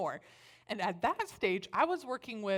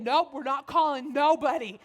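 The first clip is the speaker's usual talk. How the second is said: firm refusal; 5 semitones higher, 8 dB louder than usual. Retold or imitated.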